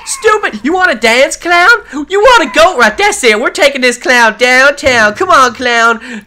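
loud, high mock-challenge to a rival; taunting trash talk. Gamer taunting the rival clown trainer, loud mock threats. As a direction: call him out like a schoolyard fight, playfully.

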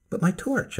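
anxious cry about the torch; cried out. Acted. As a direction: blurt it out in alarm. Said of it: "I cried".

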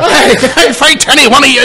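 high, loud Irish fighter's challenge; mock Irish boast through laughter; put-on Irish brogue; Conor McGregor. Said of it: "It's me, Conor McGregor".

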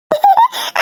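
high-pitched squealing giggle; short burst of gleeful tittering. Acted. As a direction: laugh high with mischief. Pupils gleeful about chatting freely.

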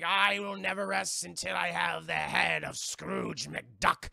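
slow, loud drawn-out ghost voice; menacing ghost. Retold or imitated. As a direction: intone the vow like a haunting spirit. Ghost swears revenge.

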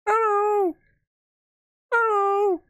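very high, stretched falsetto hello; taunt impression. Gamer trying to mimic a taunt, falsetto impression. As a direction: squeal it out as an impression. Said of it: "That's all he does".

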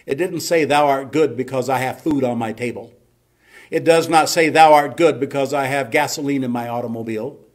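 emphatic pulpit proclamation, pitched high; full-force preaching. Real, not acted. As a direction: drive each phrase home to the congregation.

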